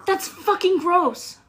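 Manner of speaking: high, disgusted cursing rant; swearing tirade. Real, not acted. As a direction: spit out each curse in revulsion. Outraged at disgusting prank.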